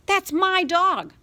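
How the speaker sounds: high, slow, loud furious insistence. Acted. Boy insisting to his mother that the dog is his. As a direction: drag it out in a rage.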